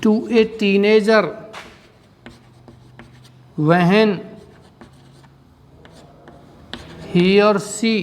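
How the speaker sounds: a few words at a time, long pauses; slow, broken-up phrases for copying; dictation. Shown as a demonstration. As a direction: dictate slowly so they can write it. लिखिए इसका answer.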